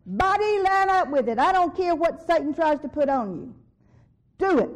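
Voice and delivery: loud, commanding rebuke, pitched high; forceful preaching. Real, not acted. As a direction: order the sickness off like a preacher. Commanding the body to heal.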